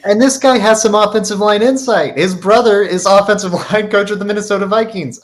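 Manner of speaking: laughing through the words, high and loud. Real, not acted. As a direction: giggle it out while teasing. Laughing while teasing a chatter.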